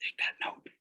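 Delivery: whispered aside; quick whispered note to the class. Real, not acted. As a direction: lean in and whisper it. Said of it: "whispered aside".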